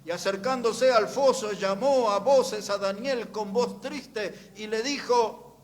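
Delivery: loud, high, fervent sermon reading; preaching at full force. Acted. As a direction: proclaim the scripture to the congregation. (predicando con toda la fuerza)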